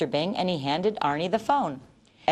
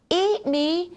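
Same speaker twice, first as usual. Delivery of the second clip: high shocked shriek; horrified squeal of disbelief; 12 semitones higher than usual. A narrator's reading. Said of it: "Arnie shrieked".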